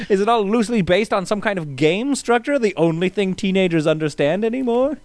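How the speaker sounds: high pitched studio executive; old-timey producer voice. Pretend. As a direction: sell it like a mogul. Continues producer bit.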